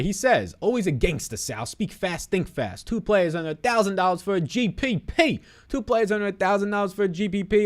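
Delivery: high, loud announcer voice; hyped-up announcer. Acted. Hypes up a chat answer.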